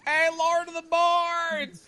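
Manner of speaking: put-on high, slow, drawn-out call of a kid fan. Fans across the room calling out, slow high drawn call. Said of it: "people over there like".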